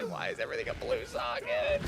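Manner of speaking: high strained whine; long-suffering. Pretend. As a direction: squeak out the despair. Despair over blue sockets.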